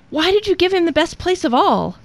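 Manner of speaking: high-pitched indignant cry; Lucifer. A narrator's reading. Demon questioning Satan's generosity, crying out. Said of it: "cried Lucifer".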